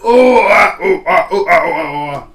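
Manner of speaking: string of loud, high oohs and ahs; loud reaction cries. Real, not acted. Stunned by the rapper's bars, loud reaction cries.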